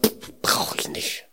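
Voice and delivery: slow, hushed puff of dismissal; puff, then shrug off the praise softly; mock offhand refusal, almost whispered; dismissive brush-off. Retold rejection of offered praise.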